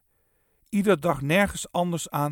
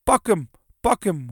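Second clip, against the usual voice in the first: raised, louder urging cry; moderately shrieked command; yelling; 4 semitones higher, 6 dB louder than usual. A narrator's reading. Wife in the hall urging the chase, yelling. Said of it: "hoorde Kees mevrouw Bron in de schutterzaal gillen".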